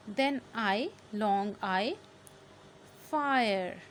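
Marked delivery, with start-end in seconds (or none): 3.1-3.8 loud, drawn-out फायर; long I. Shown as a demonstration. Teacher models a long-vowel word, stretched फायर. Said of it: "आई की आवाज़ आ रही है ना".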